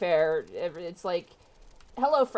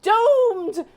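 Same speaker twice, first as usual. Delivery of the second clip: high, wailing doom-crying granny; old lady voice; 7 semitones higher, 7 dB louder than usual. Acted. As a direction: wail of doom like an old crone. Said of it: "my old lady voice".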